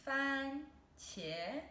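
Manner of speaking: 番茄 said slowly and clearly, fān high and level, then qié rising; teacher to viewers, spelling out 番茄 first tone then second tone, careful demo; Mandarin tones demo. Shown as a demonstration. Model 番茄 with a flat high fān and a rising qié. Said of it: "first tone and then second tone".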